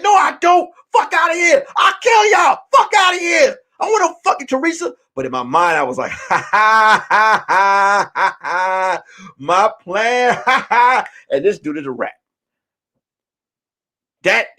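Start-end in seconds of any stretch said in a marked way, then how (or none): 0.0-4.9 high, loud mock outrage; indignant denial. Acting out loud denial to girls. 6.3-9.0 staged scheming villain laugh; long, deliberate "ha ha ha" of secret triumph; gloating laugh. Retold or imitated. Inner gloating over his plan. 9.4-11.0 mock-evil triumph breaking into laughter; gloating. Inner gloating over his plan.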